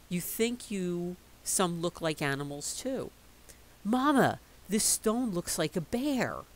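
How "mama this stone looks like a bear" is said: put-on excited child. Child calls out to mother.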